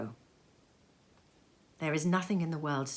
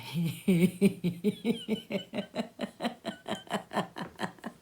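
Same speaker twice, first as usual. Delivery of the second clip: soft breathy chuckling laugh; hearty laugh; 4 semitones higher, 4 dB quieter than usual. Acted. Narrator laughing as the nephew. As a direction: laugh along warmly.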